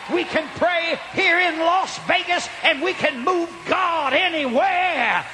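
high, slow, shouted exhortation; full-force preaching. Real, not acted. Preacher calling the congregation to shout amen, full-force preaching. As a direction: stretch the words and rouse the crowd.

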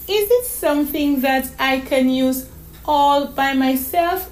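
put-on high, stammering child's voice; child carefully working out a question; pondering little girl. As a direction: think aloud like a small girl. Voices young Donna May in story.

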